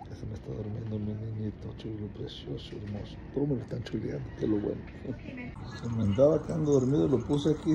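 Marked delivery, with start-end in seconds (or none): 0.1-3.1 tender lull. Real, not acted. Mother soothes her sleepy baby, softly cooing. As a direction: croon it so he drifts off.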